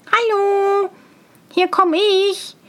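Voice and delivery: high, sustained singsong halloo; calling out. A narrator's reading. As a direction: hail the riders ahead, high and long. Tölpelhans calling out again.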